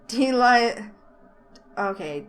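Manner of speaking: ordinary talk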